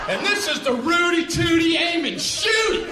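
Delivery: high, loud hammed-up ad read; toy commercial voice. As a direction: shout it like a cheesy toy ad.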